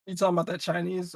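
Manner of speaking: usual speaking voice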